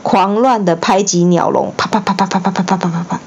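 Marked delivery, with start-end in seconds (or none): 1.8-3.2 quick patter imitating wings; rapid flapping sound. A narrator's reading. Imitating the owl beating its cage, fast. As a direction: rattle off the wing beats fast.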